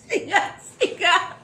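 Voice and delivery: slow, high and loud; high loud cry. Acted. Actress interrupting her monologue, slow high cry.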